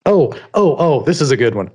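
normal talking voice

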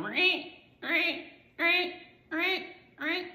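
high rising ring ring mimicking the alarm; mock alarm ringing; imitated alarm ring. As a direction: chirp each ring up high like the keypad alarm. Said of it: "you can even see it on the back side going".

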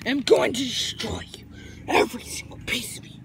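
soft, menacing whisper; hushed threat of doom; villain whisper. Acted. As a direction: whisper it like an evil overlord.

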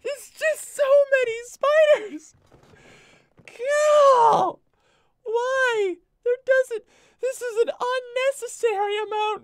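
very high, loud comic shriek; drawn-out high-pitched wail of protest; exasperated screaming. Acted. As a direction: scream in hammed-up despair. Overwhelmed by spiders, doing a bit.

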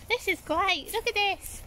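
normal talking voice

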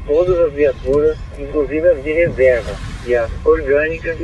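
crackly two-way radio transmission; squelchy handheld radio; police radio. Real, not acted. Dispatcher reads order over radio.

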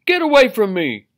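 high, loud outraged fish; indignant cry. A narrator's reading. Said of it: "cried the Rainbow Fish".

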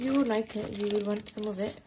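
ordinary talk

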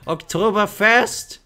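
high, loud, drawn-out cry; exuberant shout. Acted. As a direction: holler it slowly like a battle cry. Shouting a medic voice line.

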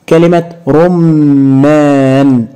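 رمان said slowly, the meem held long and doubled; stretched shadda on meem. Shown as a demonstration. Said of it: "لاحظ الميم". (نطق رمان ببطء مع تشديد الميم)

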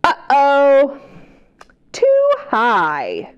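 put-on a loud, worried uh-oh for the kids; dramatic uh-oh. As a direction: gasp at the problem, then sink. Tree too high in picture book.

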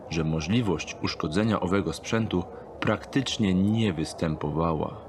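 usual speaking voice

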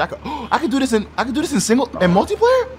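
building from surprised to thrilled squeal; excited. Real, not acted. Discovers new game feature.

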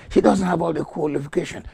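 high, loud, quick protest; mock objection. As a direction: fire off the objection high and fast. Voicing the objectors.

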